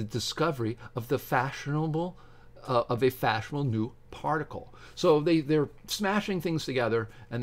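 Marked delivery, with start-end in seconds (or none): none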